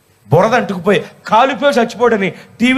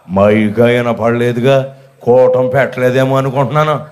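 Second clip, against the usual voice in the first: low, drawled excuse; absent churchgoer; 8 semitones lower than usual. Retold or imitated. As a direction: imitate her low, dragging reply. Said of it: "అంది". (సాగదీస్తూ తగ్గు గొంతుతో అనుకరణ)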